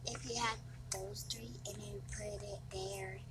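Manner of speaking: softly whispered suggestion. Real, not acted. Kid whispering a game tip to dad, whispered. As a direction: lean in and whisper the idea.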